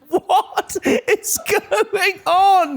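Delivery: high, slow, laughing; a slow squeal of disbelief through laughs. Retold or imitated. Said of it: "We all went".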